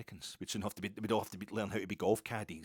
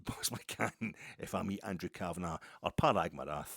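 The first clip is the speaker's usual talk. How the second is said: ordinary delivery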